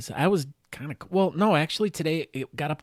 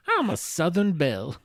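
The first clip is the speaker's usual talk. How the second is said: put-on Southern belle accent; 5 semitones higher, 3 dB louder than usual. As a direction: play a mock Southern belle, accent laid on thick. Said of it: "I'm really good at doing your accent".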